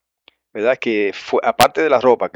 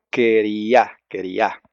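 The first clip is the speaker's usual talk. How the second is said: queriá, slow, stressed on the last A; stress on final A of queriá; 4 semitones lower, 3 dB quieter, much slower than usual. Shown as a demonstration. Teacher showing listeners the Hebrew word, queriá stressed on its final A. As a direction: land the weight on the closing á of queriá. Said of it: "con acento en la A".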